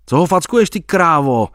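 hlasitý řev, výš; zařvi na ni naplno; zařval. Acted. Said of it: "zařval". (kamarád řve na dívku, co dala facku)

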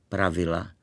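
usual speaking voice

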